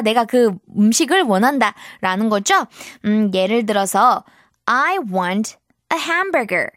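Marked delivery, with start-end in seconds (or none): 4.7-6.7 very slow, clear model line; slow model sentence. Shown as a demonstration. Teacher modelling sentence slowly for children. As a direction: say it slowly so kids can repeat. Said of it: "model sentence for repetition".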